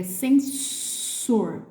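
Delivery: sensor slowly with a long hissed S; true S sound. Shown as a demonstration. Teacher contrasting with Z words, slow sensor with hissed S. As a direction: hold the voiceless S in sensor. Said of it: "S com som de S mesmo".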